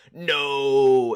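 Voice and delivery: loud, drawn-out "no" shout; hammed-up villain's last wail; mock defeated cry. Imitating Gabriel's defeat at the end, long mock shout. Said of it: "it does end with this".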